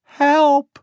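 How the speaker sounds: put-on high, loud child's cry; a small boy crying for help; boy calling. A narrator's reading. Lost boy calls to Becky for help. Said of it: "said the boy".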